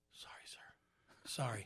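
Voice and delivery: put-on small quiet kid voice; sheepish kid apology. A kid quietly apologizing to the singer. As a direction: mumble the apology like a embarrassed child.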